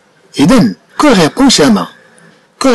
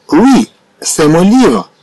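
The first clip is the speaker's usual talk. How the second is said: slow for learners; much slower than usual. Shown as a demonstration. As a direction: spell out each word of Oui, c'est mon livre slowly. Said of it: "slow repeat then normal". (نطق بطيء جدا لجملة "Oui, c'est mon livre" ليتعلم التلاميذ)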